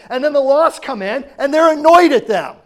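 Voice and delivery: high, loud preaching peak; preacher's loud climax; full-force preaching. Acted. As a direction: drive it home to the congregation.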